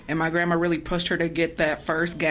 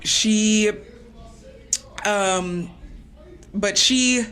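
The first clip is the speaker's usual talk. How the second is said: halting, voice rising and pulled tight; getting emotional; 3 semitones higher, slower than usual. Real, not acted. Remembering her mother's last call, choking up for real. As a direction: hold back tears between long pauses. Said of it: "I'm pausing 'cause I'm getting emotional".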